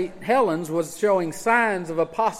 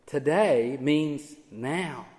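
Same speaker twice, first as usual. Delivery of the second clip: Today means now, said slowly and softer; quiet, slow emphasis; 4 semitones lower, 5 dB quieter, much slower than usual. Shown as a demonstration. Preacher to congregation, quiet slow Today means now. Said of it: "It's emphatic".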